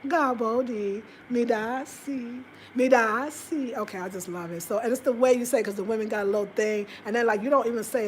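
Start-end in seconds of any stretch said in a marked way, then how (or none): 1.3-3.8 very slow "Midasi"; sounding it out. Shown as a demonstration. Vlogger slowly practicing the Twi word Midasi. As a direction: drag out "Midasi" syllable by syllable. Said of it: "phonetically sound it out".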